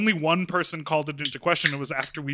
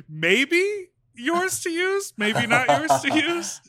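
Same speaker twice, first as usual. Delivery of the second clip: high, drawn-out lilt; careful singsong hedge; tentative hedging; 11 semitones higher, 4 dB louder, slower than usual. Real, not acted. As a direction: tread lightly, stretching the words up high. Tactfully hedging whose accent it is.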